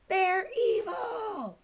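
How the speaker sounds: high, slow, dead-serious; interrupting dog. Acted. Poo Poo cuts off Stick Dog, high and slow. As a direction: cut in with grim certainty. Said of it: "Poo Poo interrupted".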